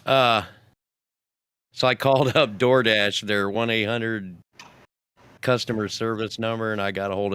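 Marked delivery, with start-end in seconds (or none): none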